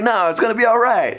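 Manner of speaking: high-pitched mock reassurance; self-reassurance. Patient telling himself after the screaming, high-pitched reassurance. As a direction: pitch it up, talking yourself round.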